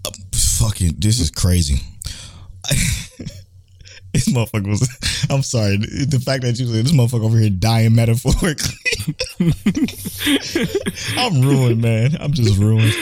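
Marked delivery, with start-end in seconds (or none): 2.5-3.3 high, breathy unvoiced laugh; silent wheeze laugh. Real, not acted. Laughing at friend's wording. 8.8-11.1 squeaky laughing burst; can't hold the laugh in; high giggle fit. Real, not acted. Laughing at friend's wording.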